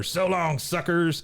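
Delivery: high, taunting call from the treetop; mock triumphant farewell. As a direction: imitate a crackpot's gloating getaway cry. Dale's taunting getaway from tree.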